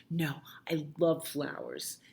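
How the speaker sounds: hushed whispered correction. Acted. Child correcting herself to parent, whispered. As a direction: whisper the love softly.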